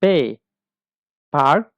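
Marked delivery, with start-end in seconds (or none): 1.3-1.7 পার্ক in German, loud and clear; German word demo. Shown as a demonstration. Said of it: "পার্ক অর্থ পার্ক". (German উচ্চারণে পার্ক)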